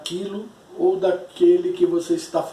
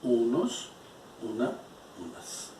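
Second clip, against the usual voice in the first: softer and softer, ending unvoiced; soft repetition drill; 6 semitones lower, 14 dB quieter than usual. Shown as a demonstration. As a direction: repeat them quietly, fading to a whisper. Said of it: "Vai ficar bem fixo".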